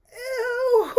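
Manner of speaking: high, loud "ew" breaking into laughter; amused disgust. Real, not acted. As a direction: squeal it out, grossed out and laughing. Disgusted reaction to the quote.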